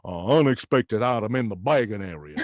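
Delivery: low [name] croon. Retold or imitated. Self-service checkout warning voiced as [name], low croon. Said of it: "as the king".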